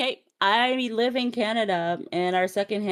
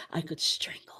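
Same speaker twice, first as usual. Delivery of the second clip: low, breathless whisper of exasperation; whispered threat; 8 semitones lower, 11 dB quieter than usual. Real, not acted. Exasperated at friend's accent claim, whispered mock threat. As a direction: whisper it as if barely holding back.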